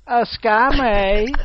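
slow, drawn-out camp delivery. Acted. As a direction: put on a drawling camp voice. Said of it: "I love that voice".

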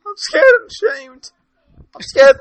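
high-pitched, laughing through words. Real, not acted. Still laughing.

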